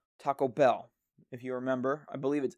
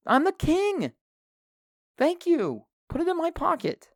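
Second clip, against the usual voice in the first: higher, hammed-up boasting, building to a yell; mock self-hype; 9 semitones higher than usual. Host brags to listeners he's the conversation king, hammed-up excited. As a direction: pump yourself up like a champ. Hyping himself as conversation mastermind.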